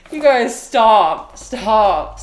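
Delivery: loud, bashful "stop it"; flustered protest. Real, not acted. Streamer tells viewers to stop, loud flustered protest. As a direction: beg them to quit, loudly.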